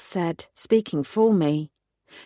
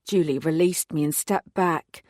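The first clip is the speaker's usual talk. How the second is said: plain reading voice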